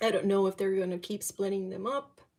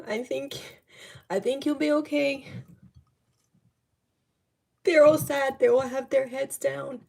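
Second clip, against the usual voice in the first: tearful relief; 4 semitones higher, 7 dB louder than usual. Real, not acted. Emotional reading, relief they live.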